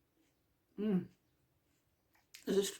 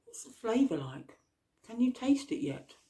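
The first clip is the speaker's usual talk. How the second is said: mouth full; 4 semitones higher than usual. Real, not acted. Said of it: "speaking with my mouth full".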